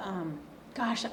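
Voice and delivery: ordinary talk, nothing marked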